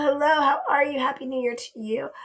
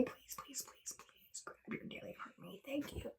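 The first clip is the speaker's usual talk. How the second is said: very soft, voiceless begging; hushed, whispered please and thanks; pleading whisper; 3 semitones higher, 23 dB quieter than usual. Real, not acted. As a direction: whisper it like a secret plea. Pleads with viewers for gifts.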